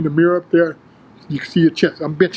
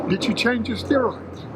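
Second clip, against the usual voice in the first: softer, slightly higher quoted question; hushed question; 4 semitones higher, 8 dB quieter than usual. Retold or imitated. Bodybuilder quietly asking a friend about steroids, hushed. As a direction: drop the volume on a discreet question.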